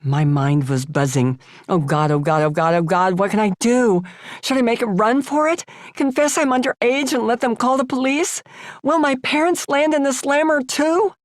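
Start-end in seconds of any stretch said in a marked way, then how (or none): 1.7-11.1 rising, higher frantic panic; panicked thoughts. Acted. Underage girl fearing the police, rising frantic panic. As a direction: spiral upward into panic. Inner panic at being caught.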